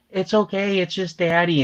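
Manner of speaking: high-pitched reassurance for a pet; baby voice. Shown as a demonstration. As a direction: soothe the cat in baby talk. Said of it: "I talk to her in a baby voice".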